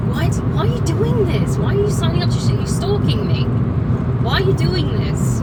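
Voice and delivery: loud, exasperated questions hurled at him; angry outburst. Real, not acted. Venting at her ex who stalked her, loud and angry. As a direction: demand an answer, fed up and furious.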